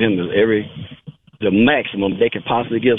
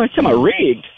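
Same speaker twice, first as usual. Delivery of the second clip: ordinary delivery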